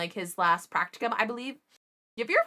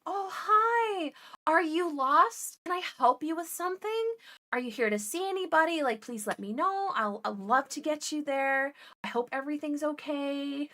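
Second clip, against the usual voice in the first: much higher, sugary helpful receptionist act; fake sweet nurse voice; 7 semitones higher than usual. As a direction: play the overly helpful nurse greeting a stranger, high and sweet. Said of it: "be like".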